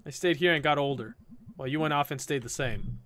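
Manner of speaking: soft, low, subdued reply; quiet resentment. Acted. Friend contrasts their lives, quiet and low. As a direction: let it sink down quietly.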